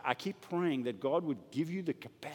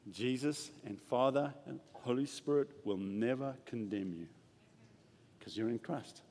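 soft, low, sincere promise; quietly tender voice of God; tender reassurance; 5 semitones lower, 5 dB quieter than usual. Acted. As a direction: speak it gently like a promise to a child.

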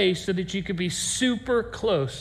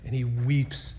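much lower and softer, heavy with grief; grieving hush; 10 semitones lower, 6 dB quieter than usual. A narrator's reading. Pastor describes Peter weeping, in a low grieving hush. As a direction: let the words sink with sorrow. Peter weeps after the rooster.

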